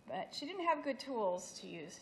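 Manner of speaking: usual speaking voice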